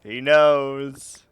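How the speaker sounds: loud, emphatic "knows"; loud declaration. Acted. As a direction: announce it loudly. Building a dramatic reveal.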